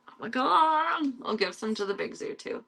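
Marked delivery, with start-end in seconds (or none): 0.1-1.1 high, slow gasped exclamation; surprised. Real, not acted. Reacting to the cats, high and amazed. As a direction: let it rise in disbelief.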